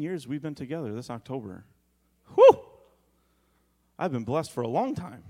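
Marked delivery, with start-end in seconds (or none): 2.3-2.6 high loud whistle of relief; whew. Real, not acted. Pastor to congregation, loud joking whew.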